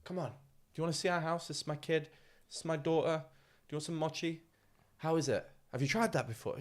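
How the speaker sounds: a hint of a cheerful farmer host; welcoming host. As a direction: show the guest around eagerly. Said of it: "They were just like".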